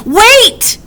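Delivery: high, loud alarmed wail. A narrator's reading. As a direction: yelp it out in fright. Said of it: "he wailed".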